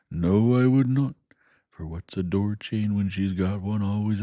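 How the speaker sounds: homely Joe spelling out the parting; Joe the blacksmith. Acted. Joe voiced in story.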